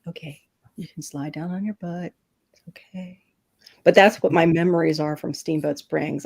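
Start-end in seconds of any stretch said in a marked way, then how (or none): 0.1-3.2 hushed, near-whispered reassurance; soothing monotone. Retold or imitated. Firefighter coaxes skier down the slope, hushed monotone. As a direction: imitate a rescuer very softly talking someone down. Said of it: "kept that monotone voice with me".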